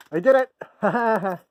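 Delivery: high, loud cry of victory; triumphant shout. Real, not acted. Triumphant shout after success.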